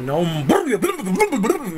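rapid rolling lip-and-tongue babble; mock fast-talk gibberish. Shown as a demonstration. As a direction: parody fast talking with blurred noise. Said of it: "だから俺なりの早口も". (早口もどきのブルブル音)

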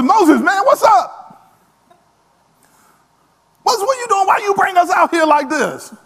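high, loud panicked complaint; complaining crowd. Retold or imitated. Israelites berate Moses at the Red Sea, loud high panic. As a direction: yell at Moses like you're sure you'll die. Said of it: "they turn to Moses".

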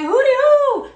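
high, loud sing-song hooty hoo; Tabitha Brown greeting. Retold or imitated. Host calls out to the universe, high loud sing-song. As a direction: call it out like Tabitha's trademark hello. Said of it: "Tabitha was like".

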